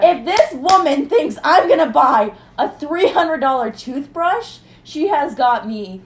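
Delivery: indignant outburst at the dentist's upsell; loud indignant rant. Real, not acted. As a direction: blast the idea she'd sell you that. Ranting about the pricey toothbrush.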